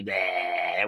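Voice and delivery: low, drawn-out mock goat bleat. Imitating how field goats greet humans, low goat bleat. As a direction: bleat like a goat, deep and long.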